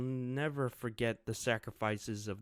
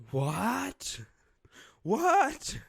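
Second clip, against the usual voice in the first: shocked squeak; 13 semitones higher, 9 dB louder than usual. Acted. Citizens' shocked reaction.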